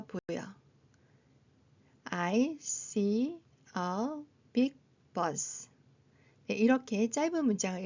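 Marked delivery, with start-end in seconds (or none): 2.0-5.7 very slow, gaps between words; word-by-word reading. Shown as a demonstration. Teacher repeating the short sentence, slowly word by word. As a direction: sound out each word for a beginner.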